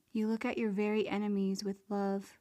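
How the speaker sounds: normal talking voice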